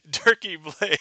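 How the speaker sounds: laughing out a name. Real, not acted. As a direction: giggle through the name. Laughing as he reads the viewer's name.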